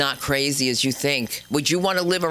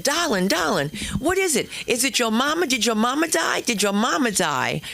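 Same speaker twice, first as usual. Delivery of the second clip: higher-pitched, lightly voiced Southern boyfriend in a fuss; worried boyfriend; 7 semitones higher than usual. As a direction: ask frantically as a fussing boyfriend. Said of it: "who was from Virginia".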